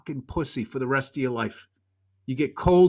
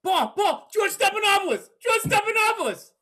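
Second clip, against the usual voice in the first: calling out. Retold or imitated. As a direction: call out to a friend across the crowd. Calling out across Madison Square Garden.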